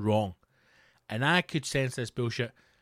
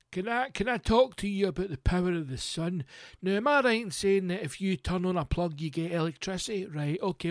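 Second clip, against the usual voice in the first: higher-pitched, pushy sales patter; caricatured cold-caller, pitched up and chattering on; doorstep salesman; 5 semitones higher than usual. Acted. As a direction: badger the householder with a chummy pitch. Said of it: "bams would come round your house".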